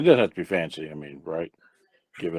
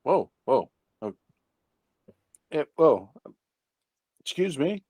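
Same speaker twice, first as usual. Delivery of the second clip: higher, halting startled reaction; 5 semitones higher than usual. Acted. Blind man stopped by a hand, startled. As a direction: act caught off guard, stumbling over words.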